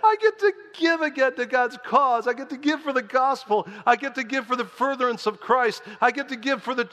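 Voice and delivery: high, loud hammed-up hilarity; breaking out laughing. Preacher models joyful giving for church, laughing wildly. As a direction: exult through big put-on belly laughs. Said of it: "let's all just break out".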